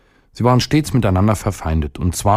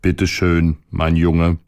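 replica dello stomaco; slower than usual. A narrator's reading. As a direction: answer the boy back without raising pitch. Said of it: "rief der Magen".

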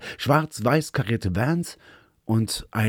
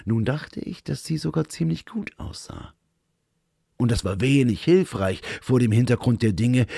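ordinary delivery, nothing marked

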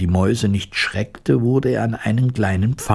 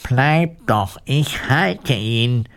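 higher, drawn-out reassuring call; 5 semitones higher, much slower than usual. A narrator's reading. Woodcutter holds the dog and calls to the fleeing mice, mildly raised. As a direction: call it over without real shouting. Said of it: "rief den Mäusen zu".

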